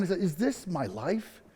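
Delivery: soft, slow, halting question; hushed disbelief, no real sobbing; retold tearful despair. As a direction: ask it quietly through imagined tears. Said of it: "I can't stop crying".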